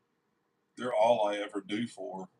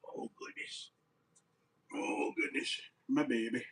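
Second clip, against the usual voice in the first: cooing at the dog; 2 semitones higher, 4 dB quieter than usual. Real, not acted. Owner cooing over the shop pup, soft whispered baby talk. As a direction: breathe it out fondly to a puppy.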